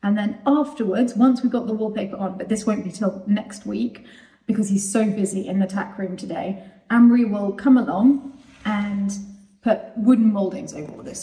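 normal talking voice

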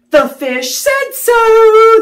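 a small creature's high, slow little voice; squeaky little pollywog, slowly. Acted. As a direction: pipe it up like a tiny tadpole.

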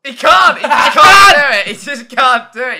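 high, very loud jeering; gleeful full-volume heckle; mocking shout. Real, not acted. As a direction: yell it out, laughing at the failure. Gleefully mocking the failed accent.

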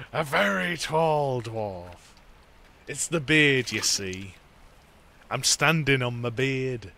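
slow, hammed-up dwarf; mock dwarf voice. Player joking that he stands on his beard, mock dwarf voice. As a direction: play the proud dwarf, drawing it out.

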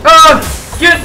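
sudden high yelp; startled cry. Real, not acted. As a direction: jolt out a loud Oh.

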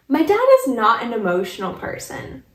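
usual speaking voice

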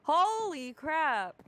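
real astonished outburst; amazed shout. Real, not acted. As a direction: stretch out the shock. Seeing the furnace setup.

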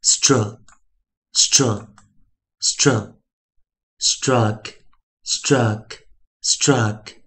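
stru as one blend "struh", then struck; slow, spaced drill from stru to struck; three separate stru blends, then three of struck; phonics blending. Shown as a demonstration. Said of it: "注意一下自然拼读的念法".

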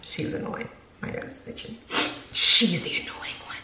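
soft conspiratorial whisper; whispered aside. Real, not acted. To viewers, teasing about her toddler in a whisper. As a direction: let it slip under her breath.

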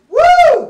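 high excited whoop; loud whoop. Real, not acted. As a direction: let out a big cheer.

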